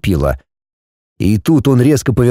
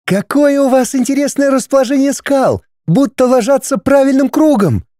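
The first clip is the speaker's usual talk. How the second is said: high shout over the wind; 14 semitones higher than usual. A narrator's reading. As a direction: call out against rushing wind. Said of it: "прокричала она, перекрикивая ветер".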